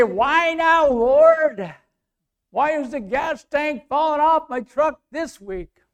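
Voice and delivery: high, loud plea to the Lord; exasperated complaint. Acted. Preacher acting out a complaint to God, exasperated. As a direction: wail it upward in mock exasperation.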